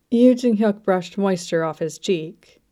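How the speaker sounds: ordinary delivery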